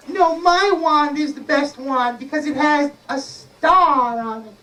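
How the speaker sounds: high-pitched, contrary little fairy. Acted. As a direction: argue back in a high fairy voice. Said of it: "But the fairy said".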